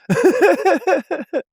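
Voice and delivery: high, bubbly giggle falling off; amused giggle. Real, not acted. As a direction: laugh at the coincidence. Laughing at funny coincidence.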